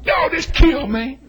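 ordinary talk, nothing marked

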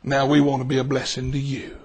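low, soft and heartfelt; tender sincerity. Retold or imitated. Customer gives waitress the big tip, tenderly sincere. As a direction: offer it quietly, deep in the voice.

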